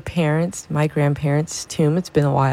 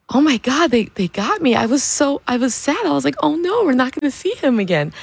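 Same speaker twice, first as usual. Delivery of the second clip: higher, mock-dismayed mom; mom's dismay; 9 semitones higher than usual. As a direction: lightly play the mom caught out. Mom realizes the joke, voiced.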